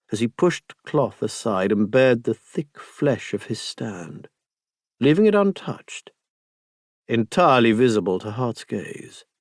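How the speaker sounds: plain reading voice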